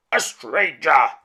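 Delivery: slow, loud beastly roar. A narrator's reading. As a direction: roar it out at the intruder. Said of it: "he roared".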